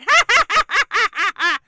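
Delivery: high, loud mechanical ha-ha-ha; skeleton cackle. Acted. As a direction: cackle like a Halloween skeleton toy. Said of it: "Those are some very accurate cackles".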